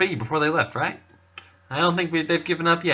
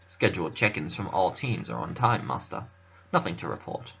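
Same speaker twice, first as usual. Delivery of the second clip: lower, softer, dignified butler; lightly voiced butler; 5 semitones lower, 6 dB quieter than usual. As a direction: report calmly like a discreet servant. Butler voice reports to master.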